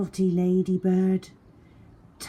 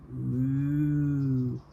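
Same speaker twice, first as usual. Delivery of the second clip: mooing cow. A narrator's reading. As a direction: moo like a cow. Cow sound in story.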